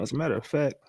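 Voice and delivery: ordinary delivery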